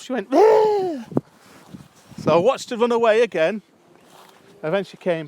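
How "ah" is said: very high, loud mock shriek; panicked scream. Italian lady running from the bee again, panicked shriek. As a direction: shriek as if fleeing in fright. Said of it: "she just ran off".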